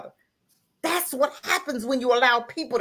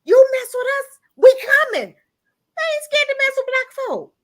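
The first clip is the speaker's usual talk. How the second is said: much higher, near-crying outcry; impassioned outcry; 14 semitones higher, 4 dB louder than usual. Real, not acted. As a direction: pitch the plea way up, voice straining with feeling. Heated rant about boycotts.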